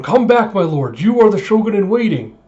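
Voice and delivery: high, loud frantic pleading; freaking out. Retold or imitated. Retainer begging his lord to return, frantic. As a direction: cry out in hammed-up panic, dipping only on the title. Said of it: "Izo's just freaking out".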